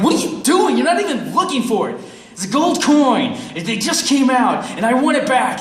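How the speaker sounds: high, loud comic tirade; acted exasperation. Storyteller berating beggar for not looking, exasperated demand. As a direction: argue loudly, pitch way up, fed up.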